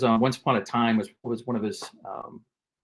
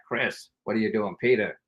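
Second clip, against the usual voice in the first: softer, lightly imitated editor; Morgan Freeman; 5 dB quieter than usual. Editor to his staff in the scene, understated imitation. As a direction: address them by name without yelling. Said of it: "instead of yelling ... it was like".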